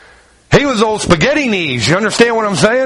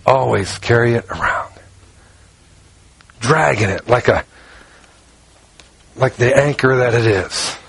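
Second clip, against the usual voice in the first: heavy low; 8 semitones lower than usual. Real, not acted. Preacher drops low, heavy.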